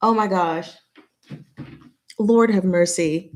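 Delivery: ordinary talk, nothing marked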